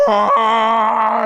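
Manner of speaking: high, loud held scream; power-up yell. Retold or imitated. Reviewer mimicking Kuu powering up, high loud yell.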